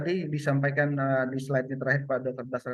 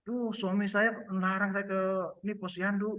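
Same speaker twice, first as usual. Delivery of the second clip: higher-pitched acted mother-to-be; pregnant woman complaining; 6 semitones higher than usual. Pregnant woman tells health worker of husband's ban, complaining. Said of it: "kalau ada ibu hamil bilang".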